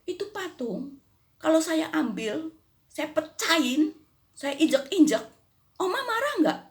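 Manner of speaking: high mocking neighbour's challenge; provoking neighbour. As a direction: taunt the old lady. Neighbour threatens to smash statue.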